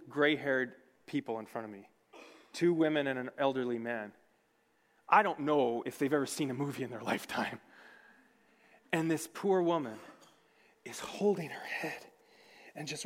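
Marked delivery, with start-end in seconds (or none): none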